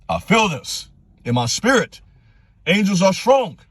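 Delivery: high-pitched passionate exhortation; impassioned. Real, not acted. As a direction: let the conviction lift your voice high. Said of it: "This is passion".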